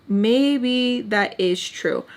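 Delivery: drawn out, word by word; slow pondering. Retold or imitated. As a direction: let it sink in slowly. Retold self-talk, slowed repeat.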